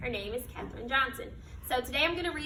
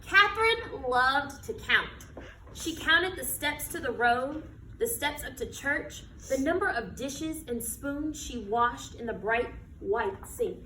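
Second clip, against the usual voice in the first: slow, expressive picture-book reading; reading to children; 4 semitones higher, slower than usual. Acted. Teacher reading a picture book to her class, storytelling voice. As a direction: read it like storytime on the rug.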